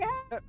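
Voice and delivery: high-pitched little yelp; tiny comic shriek; mock scream. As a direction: squeal in pretend panic. Acting panicked reply to sacrifice order.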